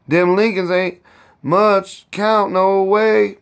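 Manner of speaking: pitched high, slow and scornful; high, drawn-out sniff of contempt; contemptuous. A narrator's reading. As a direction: dismiss the neighbors with scorn. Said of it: "said Aunt Chloe contemptuously".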